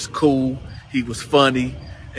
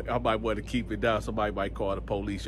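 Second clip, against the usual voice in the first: low, soft quoted caution; hushed warning; 6 semitones lower, 10 dB quieter, faster than usual. Retold or imitated. Wife telling him to keep it down, hushed and low. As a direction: imitate a wife quietly warning you.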